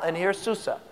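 usual speaking voice

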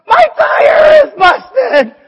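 hammed-up panicked cry over a flat tire; desperate shout. Preacher imitates the frantic commercial take, hammed-up shouting. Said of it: "there's one take where he's like".

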